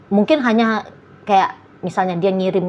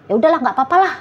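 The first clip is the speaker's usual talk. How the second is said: higher, irritated mock acceptance; emotional resignation; 6 semitones higher, 3 dB louder, much faster than usual. Partner pretends to let go while upset, in a higher irritated tone. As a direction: say it's fine while clearly upset. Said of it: "Tapi sambil emosi nih".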